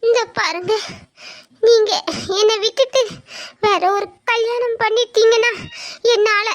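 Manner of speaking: desperate, tearful warning; broken, weeping plea; crying. Acted. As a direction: sob out a few words at a time. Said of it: "அக்கா ஏன் இப்படி அழுறா?".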